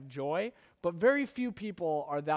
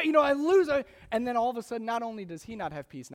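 ordinary talk

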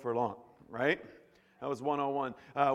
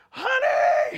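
much higher and louder, an outraged yell; screaming husband; 9 dB louder than usual. Acted. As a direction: shout for her from the closet. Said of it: "he screams".

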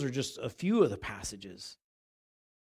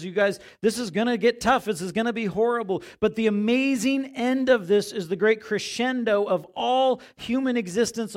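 louder, higher building proclamation; fervent preaching; 7 semitones higher, 7 dB louder than usual. Retold or imitated. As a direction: preach the great crescendo with fire. Jesus voiced, preaching climax.

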